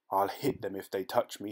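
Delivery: ordinary delivery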